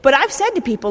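ordinary talk, nothing marked

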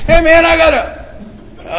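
high, drawn-out hopeful opener; eager phone greeting. Retold or imitated. Caller trying the next number for help, eager. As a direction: pitch it up as you start asking.

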